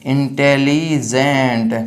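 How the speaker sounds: Intelligent slowly, as Intelli then gent; syllable split. Shown as a demonstration. Teacher dividing the word for students, slow Intelli-gent. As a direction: draw out intelligent in separate chunks. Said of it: "यहाँ पर एक syllable हो गया".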